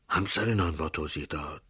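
ordinary delivery, nothing marked

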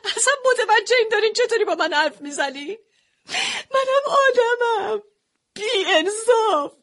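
a wife crying and shouting at her father-in-law; bursting into tears. Acted. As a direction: cry out her hurt, voice breaking high. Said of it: "زدم زیر گریه".

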